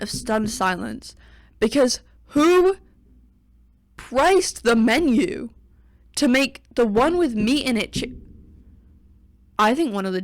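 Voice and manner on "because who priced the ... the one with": indignant. Real, not acted. Indignant question about pricing.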